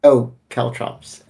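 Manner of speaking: ordinary talk, nothing marked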